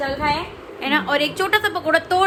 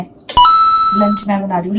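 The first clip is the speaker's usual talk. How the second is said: मैं stressed, slow and louder; proud emphasis; 7 semitones lower, 7 dB louder, slower than usual. Real, not acted. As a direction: slowly lean on मैं, I'm cooking today. Proudly takes over lunch duty.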